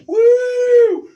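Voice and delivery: a high, loud whoop of excitement; wild whoop. Retold or imitated. Dodging cars, whooping.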